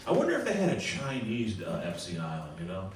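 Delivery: normal talking voice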